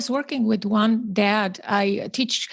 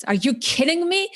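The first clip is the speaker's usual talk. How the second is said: imitating an incredulous, angry father, higher pitch; retold anger; 8 semitones higher than usual. Dad snapping back at his parenting teacher, retold indignation. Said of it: "looked at me with such anger".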